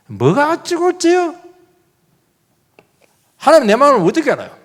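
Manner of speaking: high, indignant retort; mock the stubborn brother; mocking Cain.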